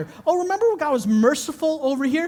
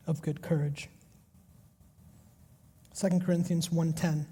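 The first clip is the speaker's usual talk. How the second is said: quiet reflective preaching; 7 semitones lower, 4 dB quieter than usual. Acted. Pastor reflecting on Paul's letter, soft and low. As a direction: speak gently as if praying.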